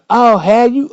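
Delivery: high, loud sarcastic retort; mock her scoff; sarcastic girlfriend. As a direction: girlfriend sneering back.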